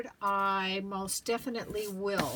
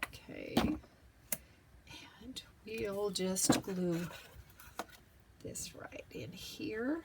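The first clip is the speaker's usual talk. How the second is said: whispering; 5 dB quieter than usual. Real, not acted. Hushed while gluing.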